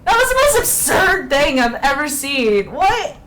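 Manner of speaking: loud, high-pitched disbelief; incredulous outburst. Real, not acted. Streamer reacting to fireworks for an encore, loudly incredulous. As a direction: exclaim it at full volume, utterly baffled.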